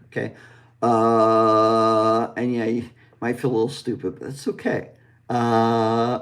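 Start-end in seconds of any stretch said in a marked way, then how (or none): 0.8-2.3 held neutral uh from the chest; neutral vowel. Shown as a demonstration. Teacher demonstrating the neutral vowel, held loud uh. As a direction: hold a loud relaxed uh. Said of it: "say that with me".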